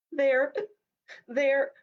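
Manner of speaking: high hiccuping gulps. Acted. Rabbit hiccuping as he explains to the horse. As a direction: hiccup each word through tears. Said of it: "Rufus hiccuped".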